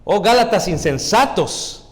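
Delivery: loud, high, slow exclamation; shouting. Acted. Paul shouting at the foolish Galatians, loud rebuke. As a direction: cry it out like Paul rebuking them. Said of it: "y está gritando".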